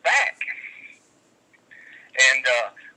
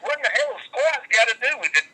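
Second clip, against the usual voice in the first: higher, sarcastic disbelief; exasperated retort; 9 semitones higher than usual. Retold or imitated. Wrestler retorting to the dude, exasperated. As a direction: throw the question back at him. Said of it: "I was like".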